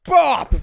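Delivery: a sharp cartoon hit; impact sound effect. Host acting out Enid getting knocked out, loud comic impact noise. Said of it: "visual representation of Enid running in".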